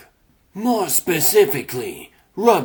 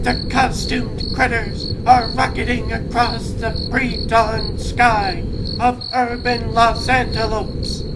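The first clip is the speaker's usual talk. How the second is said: higher, lively cartoon narrator; story narration; 9 semitones higher than usual. A narrator's reading. Narrator describing the heroes rocketing across the sky, lively. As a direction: tell it like an excited announcer.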